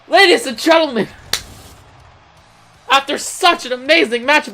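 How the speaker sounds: high, excited match-ending call; excited announcement. Real, not acted. Commentator hailing the audience at the finish, excited.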